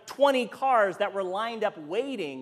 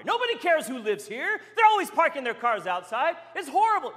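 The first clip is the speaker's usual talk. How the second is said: angry complaint; 8 semitones higher than usual. Retold or imitated.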